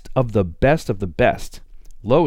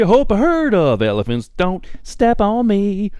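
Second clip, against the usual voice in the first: high silly voice; 8 semitones higher, 4 dB louder than usual. Acted. Silly mic check.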